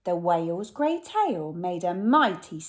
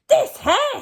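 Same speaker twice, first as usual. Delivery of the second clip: much higher and louder, a squeaky robber; robber Hugh; 9 dB louder than usual. Acted. Robber Hugh spots the singing whale, high and loud. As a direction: pipe it up like an eager crook. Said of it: "said Hugh".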